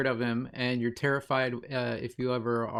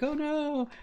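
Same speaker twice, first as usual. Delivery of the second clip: much higher, hammed-up yelp of alarm; mock fright. A sailor acting terrified as the pirate boards, high mock yelp. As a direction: squeal it like a cartoon victim.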